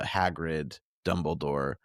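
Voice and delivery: usual speaking voice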